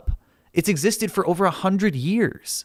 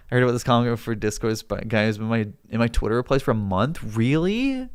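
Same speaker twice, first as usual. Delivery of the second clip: ordinary talk, nothing marked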